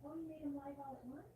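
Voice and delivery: distant off-mic chat; talking across the room; far from mic. Real, not acted. Chatting with Randy off-mic.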